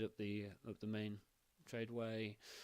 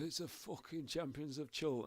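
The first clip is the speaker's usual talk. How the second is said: put-on higher, jeering drunk call; drunken jeer; 8 semitones higher, 3 dB louder than usual. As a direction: heckle them as they ride past. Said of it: "he goes".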